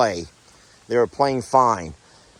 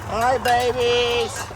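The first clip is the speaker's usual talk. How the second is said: higher, drawn-out cooing greeting; slow coo; 9 semitones higher, much slower than usual. Real, not acted. Farmer greeting her baby goats with a slow, high coo. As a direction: stretch the hello out tenderly.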